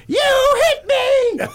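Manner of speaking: very high, loud, stretched-out shriek; old lady shriek. Retold or imitated. Old lady accusing him in the aisle, loud high shriek. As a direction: screech it for the whole store to hear. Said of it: "Like that".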